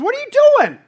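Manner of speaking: high, loud cry of disbelief; exasperated rebuke. Retold or imitated. Preacher voicing James scolding believers, loud and exasperated. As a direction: shout it as an indignant challenge.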